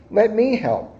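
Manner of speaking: a cheerful offer to help; elephant voice. Acted. Elephant voiced in picture book.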